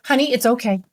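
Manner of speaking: wife softening, mock tender; soothing wife. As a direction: comfort him now that he owns it. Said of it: "she was like".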